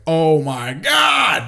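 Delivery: hugely drawn-out, slow exclamation; retold awe. Man recalling first hearing her thick accent, drawn-out mock awe. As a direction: swoon loudly, stretching every word out.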